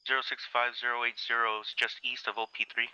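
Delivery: tinny radio transmission voice; crackly military radio. Real, not acted. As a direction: read coordinates over a field radio. Grid reference read over radio.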